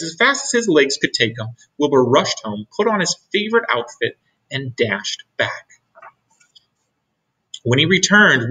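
ordinary delivery

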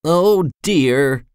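a mild, rueful sigh of a line; understated sighing complaint. A narrator's reading. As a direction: sigh it gently, a touch sorry for yourself. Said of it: "he used to sigh".

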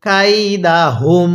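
very slow "কাইদাহুম", long syllables; model recitation. Shown as a demonstration. Teacher has learners read along, very slow "কাইদাহুম". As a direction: stretch "কাইদাহুম" out, humming the final m. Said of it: "হ্যাঁ, পড়ুন".

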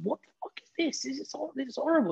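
high, appalled mother's outburst; shocked mum. Retold or imitated. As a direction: mum recoiling at the newspaper. Mum reading son's tabloid story.

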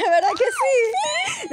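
high, slow laughing squeal. Real, not acted. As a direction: squeal it through giggles. Risa nerviosa tras cumplidos. (chillido entre risas)

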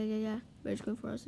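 normal talking voice

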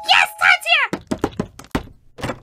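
high, loud squeal of delight; excited yell. Acted. Becky hears her crush at the door, excited yell. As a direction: shriek it out, thrilled.

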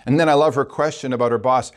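ordinary talk, nothing marked

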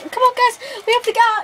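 high, loud frantic urging; urgent shout. Real, not acted. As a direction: yell for them to break free. Urging during submission hold.

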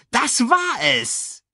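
high, slow hyena gloating. A narrator's reading. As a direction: stretch it out like a cackling hyena. (hohe, langsame Hyänenstimme)

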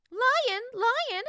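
high, loud, delighted little bird; a high chirpy bird voice, thrilled; delighted chirp. A narrator's reading. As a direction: call out to the lion in pure delight. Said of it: "the bird chirped, delighted".

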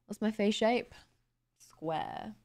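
normal talking voice

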